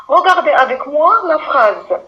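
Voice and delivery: normal talking voice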